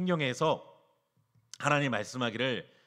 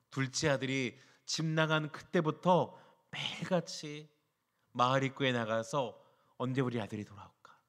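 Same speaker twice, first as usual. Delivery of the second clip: normal talking voice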